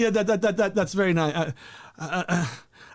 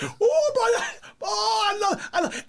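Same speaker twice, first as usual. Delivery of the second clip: very high laughing ooh; mock mom squeal; 13 semitones higher than usual. Son imitating mother on video, high squeal. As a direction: squeal with delight. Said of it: "She's like".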